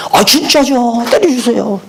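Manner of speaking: high eager plea; eager students. Retold or imitated. Students confirm.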